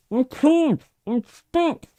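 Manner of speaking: small high kender voice, muffled and panicked. A narrator's reading. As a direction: squeal it from inside the dragon head. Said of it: "came a muffled voice".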